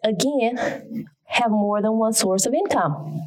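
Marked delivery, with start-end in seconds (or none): none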